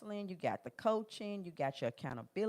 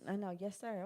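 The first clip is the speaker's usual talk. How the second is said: normal talking voice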